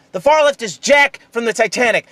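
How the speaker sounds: ordinary talk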